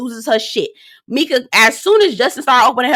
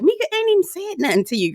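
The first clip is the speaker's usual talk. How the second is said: high incredulous clapback; incredulous retort; 9 semitones higher, 4 dB quieter, faster than usual. Retold or imitated. Her retort to Alexis, high and incredulous. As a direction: scoff at her, pitched up. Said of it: "I was like".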